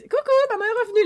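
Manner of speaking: high, loud, quick sing-song coucou; a mom announcing her surprise return; cheerful mom greeting. Acted. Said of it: "la surprise". (petit coucou de maman, aigu et rapide)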